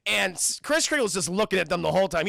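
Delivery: usual speaking voice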